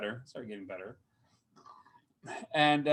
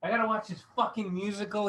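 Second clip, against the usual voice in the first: mock exasperation; 7 semitones higher than usual. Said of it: "I was like".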